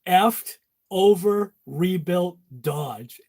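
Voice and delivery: drawn out slowly, word by word; slow punchline delivery. Shown as a demonstration. To viewers, slow deliberate joke reveal.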